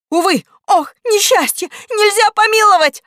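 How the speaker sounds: high, loud wailing lament; mock sobbing. Exclamation mark mourns no pardon, wailing. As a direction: wail it theatrically at the top of your range. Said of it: "зарыдал восклицательный".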